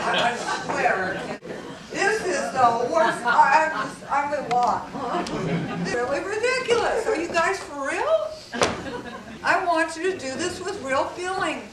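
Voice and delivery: laughing through words, high and loud; can barely talk for laughing; cracking up at the audience. Real, not acted. Audience patting themselves, speaker laughing.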